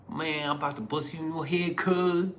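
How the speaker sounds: hammed-up thug voice mocking local slang; mock street threat. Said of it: "They was talking like that".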